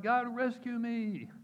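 high, loud, drawn-out cry for rescue; hammed-up drowning man's plea; retold desperate cry. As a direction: cry out to heaven, stretching the words. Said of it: "he cries out".

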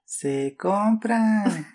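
slow, drawn-out street vendor call; crackly looped vendor recording. Retold or imitated. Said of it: "Ya sé qué sonido es".

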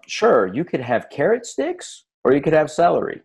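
high, singsong mock-agreeable parent; parent offering choices. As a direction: pitch it up, sweetly offering a child options. Parent offering kid two choices.

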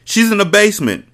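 loud, high shout; yelled. A narrator's reading. Mecca yelling at Mati before firing. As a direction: yell it before the shot. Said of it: "Mecca yelled".

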